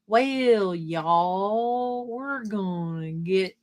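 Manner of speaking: hammed-up, dragged-out Southern drawl; slow Southern drawl. Shown as a demonstration. Streamer shows chat why she talks fast, exaggerated slow Southern drawl. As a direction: stretch every word way out, Southern style. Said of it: "with a Southern accent and you talk slow".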